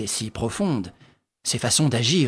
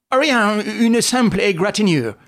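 high, loud insistence trying to convince himself; forced reassurance; 8 semitones higher, 4 dB louder than usual. A narrator's reading. Sholmès telling the baron the wound is nothing, high forced reassurance. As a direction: repeat it as if wishing it true. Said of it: "cherchant à s'illusionner".

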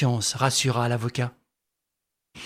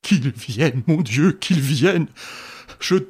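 stammering; 4 semitones higher than usual. A narrator's reading. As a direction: beg through a crushed voice.

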